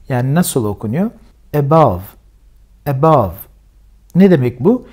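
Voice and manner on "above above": Above with the first a as a schwa, stress on bove; model pronunciation. Shown as a demonstration. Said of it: "vurgu şuradadır".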